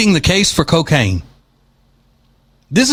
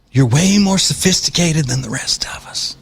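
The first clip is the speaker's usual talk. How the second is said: sarcastic whisper. Pretend. Sarcastic jab at the reporter.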